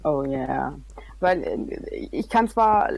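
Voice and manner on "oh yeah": deep voice. Shown as a demonstration. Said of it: "eine tiefe Stimme, mit tiefer Stimme sprechen".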